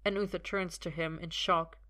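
ordinary delivery, nothing marked

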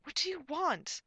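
loud, high, slow demand; defiant. A narrator's reading. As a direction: fling the question defiantly. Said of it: "glared up defiantly at them".

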